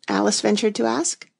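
usual speaking voice

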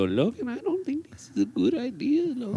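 high, thin put-on conscience; voice in head. Warning Logan before something stupid, high inner voice. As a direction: pitch it way up as inner voice. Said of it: "you hear the voice in your head go".

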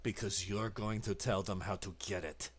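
quietly crush her protest; low cold reply. Acted. Arturo answers the creature, low and menacing. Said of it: "He's like".

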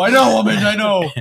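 exasperated husband. Retold or imitated. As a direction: snap back wearily at the wife. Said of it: "It's like".

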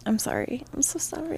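breathy whispered apology. Real, not acted. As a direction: whisper it, hushed and sorry.

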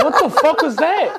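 laughing, slow and high. Real, not acted. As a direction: ask through laughter. Reacting to friend's weird voice.

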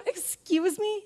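high offended question; indignant. Retold or imitated. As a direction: ask it affronted. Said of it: "I go".

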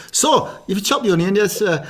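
upbeat telly chef piece to camera; TV cooking presenter. Retold or imitated. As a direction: present it like a cookery show host. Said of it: "I was doing full throttle".

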